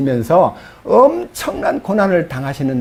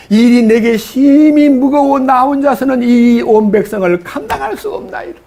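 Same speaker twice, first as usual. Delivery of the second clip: despairing plea; 6 semitones higher, 3 dB louder than usual. Retold or imitated. Moses to God, unable to bear the people, despairing plea. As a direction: let it climb, then collapse. Moses says burden too heavy.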